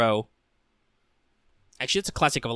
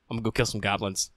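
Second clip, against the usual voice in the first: softer, toneless announcement; Goblin Slayer deadpan; 3 semitones lower, 6 dB quieter, faster than usual. Acted. Hero walking up to the party's table, deadpan. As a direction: state it quietly, no feeling. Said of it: "he is in his normal deadpan voice".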